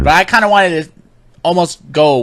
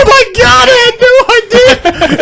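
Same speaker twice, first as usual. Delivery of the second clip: very high, loud panicked wail; mock freaking out; 5 dB louder, slower than usual. Wrestler learning who he insulted, mock panic. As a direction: wail it like a hammed-up meltdown. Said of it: "Braun Strowman was freaking out".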